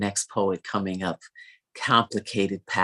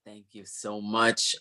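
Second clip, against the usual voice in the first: ordinary talk, nothing marked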